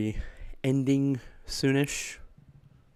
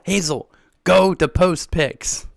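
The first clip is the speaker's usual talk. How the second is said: slow, louder put-on voice; exasperated; 6 semitones higher, 7 dB louder, slower than usual. Real, not acted. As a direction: spell it out for a forgetful friend. Scolding chatter jokingly.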